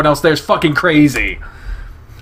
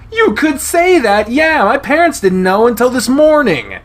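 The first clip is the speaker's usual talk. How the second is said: flustered; 12 semitones higher, 6 dB louder than usual. Acted. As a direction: blurt it out, pitched high. Chase admits parents found out.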